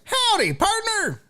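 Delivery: high-pitched twangy cowboy caricature; country kicker voice. Acted. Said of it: "country kicker voice".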